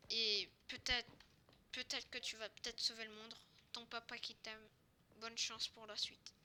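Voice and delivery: low, soft, halting letter reading; reading a letter aloud. Pretend. Father's letter to son before saving the world, low and soft. Attends, une lettre.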